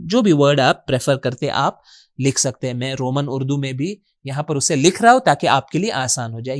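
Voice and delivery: usual speaking voice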